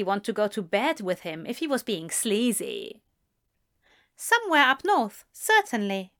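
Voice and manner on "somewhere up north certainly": higher, prim and proper reply. A narrator's reading. Aziraphale placing Crowley's accent, raised and prim. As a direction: say it primly, a little superior. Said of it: "Aziraphale said primly".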